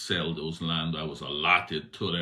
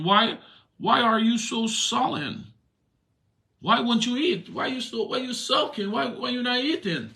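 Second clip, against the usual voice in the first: much higher, mocking queen's voice; Jezebel's voice; 7 dB louder than usual. Queen Jezebel scolding husband Ahab for sulking, much higher mocking voice. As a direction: needle the sulking king in a shrill-high put-on voice.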